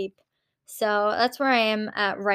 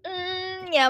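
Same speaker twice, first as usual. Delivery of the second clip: normal talking voice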